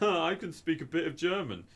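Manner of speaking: high-pitched mock show-off; smart aleck. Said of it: "being a smart aleck on Twitch".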